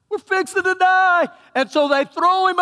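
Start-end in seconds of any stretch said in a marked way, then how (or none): 0.1-1.3 much higher, louder cry "We're fixing to die"; hammed-up terror; panicked sailors. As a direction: yell it like the boat's sinking.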